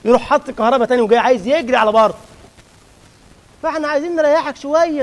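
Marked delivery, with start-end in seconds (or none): 3.6-5.0 raised pleading voice. Acted. Addressing restless worshipper directly.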